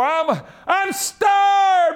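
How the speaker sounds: high, drawn-out imitated holler; mimicked high-pitched wake-up shout; Brother Buck Moore. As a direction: cry it out high, stretching the last word. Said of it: "His was like high-pitched".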